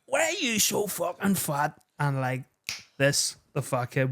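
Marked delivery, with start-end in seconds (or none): 0.0-1.8 mocking kid. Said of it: "he says to him".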